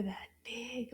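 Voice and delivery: put-on hushed, secretive question to the kids; playful whispered quiz question.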